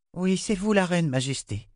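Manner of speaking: put-on a child saying sorry to the queen; young girl Rachel. A narrator's reading. Girl apologizing to the queen, meek.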